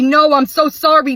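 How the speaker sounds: high, loud frantic "sorry"; husband pleading at the door, high and loud; desperate apology. Acted. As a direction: cry out "sorry" to stop her leaving. Abuser begging her not to leave.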